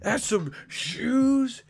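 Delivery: high, slow, squealing fan voice; shrieking fake excitement over shoes; mock hyped fan. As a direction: ham up the gushing fan, stretching each word. Mocking the fan again.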